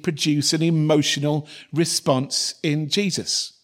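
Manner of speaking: normal talking voice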